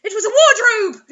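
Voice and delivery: loud burst of laughter; laughing exclamation; laughing misread. Real, not acted. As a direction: crack up at the mistake.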